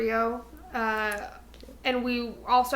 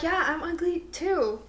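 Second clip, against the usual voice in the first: a light hint of a Mean Girls girl; breezy mock agreement, lightly voiced; Mean Girls quote; 4 semitones higher, 3 dB quieter than usual. Quoting Mean Girls to her friend, lightly voiced. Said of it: "it's like Mean Girls, where she's like".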